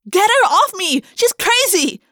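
high, loud cry for help; frantic high-pitched shout; panicked cry. A narrator's reading. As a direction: yell it high in alarm. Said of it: "Jessica cried".

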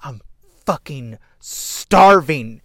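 loud slow imitation of a crazed movie villain; unhinged psycho line read. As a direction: spit out the threat like a starving cannibal. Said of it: "line reads 'cause he has ... he goes".

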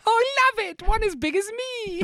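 high squealing delight; excited kid. Pretend. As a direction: gush like a thrilled child. Mock British kid cheering.

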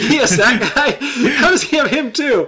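high, loud laughing disbelief; laughing in shocked disbelief. Real, not acted. Disbelief at a rival's roster.

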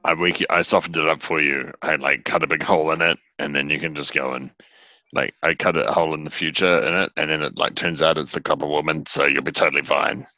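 usual speaking voice

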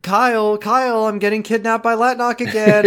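high, hammed-up damsel in distress; playacted kidnap victim; mock cry for help. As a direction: call out in pretend alarm, pitched high. Voices kidnapped Amanda calling Kyle.

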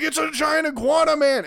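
put-on high, loud kid's thrill; mock excited kid. Kid seeing the alien, high hammed-up excitement. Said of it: "He's like".

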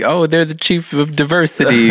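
mocking corporate voice. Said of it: "it's like".

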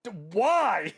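high, loud cry of despair; anguished scream. Retold or imitated. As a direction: wail at the book for hurting him. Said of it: "I knew I was gonna scream there".

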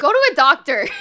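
loud, high interruption; loud blurt. Real, not acted. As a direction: blurt it out over them.

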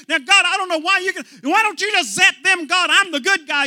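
high, fast complaining prayer, near a shout; self-righteous whining. Acted. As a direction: whine to God like a wronged child. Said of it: "hearing about our self-righteousness".

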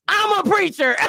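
high-pitched gleeful proclamation; playful boast. Pretend. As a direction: crow it high, joking at your own preaching. Jokes after finishing her prayer.

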